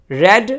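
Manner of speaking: "read" said as red, with a short e; short e sound. Shown as a demonstration. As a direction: say "read" like red, the third form. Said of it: "third form को रेड". ("read" को रेड बोलें, छोटी ए के साथ)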